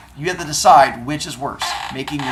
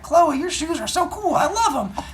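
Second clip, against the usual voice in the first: put-on high, gushing kid voice with a laugh; mock a boy fawning over shoes; teacher mimics student complimenting classmate, gushing and high; 13 semitones higher than usual. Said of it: "and he's like".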